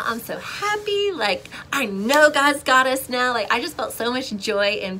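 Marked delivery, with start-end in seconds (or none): 0.0-3.3 higher, slower, beaming; giddy joy in the aisle; joyful. Retold or imitated. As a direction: glow with happiness. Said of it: "I'm like".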